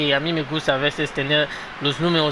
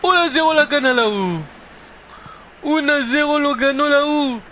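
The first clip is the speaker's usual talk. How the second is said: high shouted goal call; breakneck goal commentary; 13 semitones higher, 5 dB louder, slower than usual. Acted.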